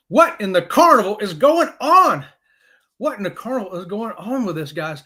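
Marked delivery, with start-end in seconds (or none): 0.1-2.2 slow, loud, exasperated demand; high, drawn-out comic exasperation; mock outrage. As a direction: punch out each word in bafflement. Mock outrage at card confusion.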